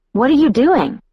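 high-pitched protest; indignant question, voice jumping high; objecting. A narrator's reading. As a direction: object sharply, pitch leaping up. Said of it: "I objected".

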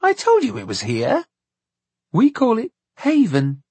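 put-on high, indignant child voice. A narrator's reading. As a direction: insist like an affronted little girl. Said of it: "Laura Glue said indignantly".

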